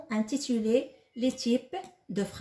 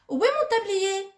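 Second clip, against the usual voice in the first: "où est mon tablier?" asked high and louder, as a real question; 10 semitones higher, 5 dB louder than usual. Shown as a demonstration. Teacher voicing a sample question to pupils, high questioning tone. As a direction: act out the question brightly, pitch up. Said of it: "Ici on pose une question".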